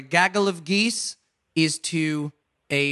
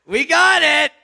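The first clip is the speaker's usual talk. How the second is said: excited; 11 semitones higher, 6 dB louder, slower than usual. Real, not acted. Celebrating right answer.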